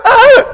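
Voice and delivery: high, loud scream of terror; hammed-up scream while going under; panicked scream. Peter starting to sink, screaming in panic.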